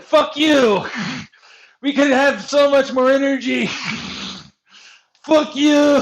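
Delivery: high, loud shouting with laughs between; furious yell. Retold or imitated. Player yells at the country that rejected him, loud high laughing shout. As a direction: scream it at them, laughing. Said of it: "But it's like".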